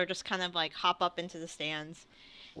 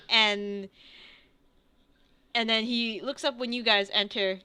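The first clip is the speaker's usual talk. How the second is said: ordinary talk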